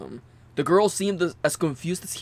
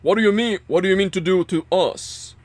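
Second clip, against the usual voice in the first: harsh villain voice; 5 semitones higher, 5 dB louder than usual. Acted. Zero answers Junpei.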